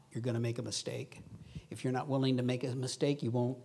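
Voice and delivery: ordinary talk, nothing marked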